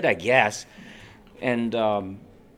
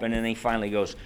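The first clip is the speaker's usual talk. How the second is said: ordinary talk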